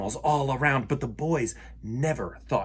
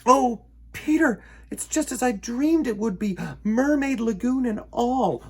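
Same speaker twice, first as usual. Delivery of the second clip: lightly voiced young girl, higher; a touch of wonder; dreamy girl; 10 semitones higher than usual. Wendy tells Peter her dream came true, lightly voiced. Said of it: "Wendy said".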